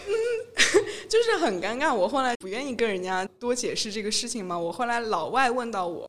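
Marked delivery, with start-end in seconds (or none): 0.2-1.0 high, loud teary laugh; laughing till near tears. Real, not acted. Laughing at the friends' jokes, nearly in tears. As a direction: laugh almost to crying.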